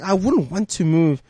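ordinary delivery

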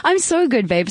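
high, playful babe; camp reply. Pretend. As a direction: answer in a bright camp squeal.

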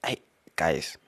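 whispered aside to the guys; conspiratorial whisper to listeners. Real, not acted. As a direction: lean in and whisper it.

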